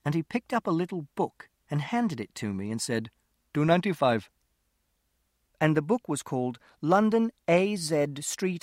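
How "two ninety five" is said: put-on lightly accented Indian shopkeeper; a touch of an Indian shopkeeper's accent. A narrator's reading. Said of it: "because of his accent".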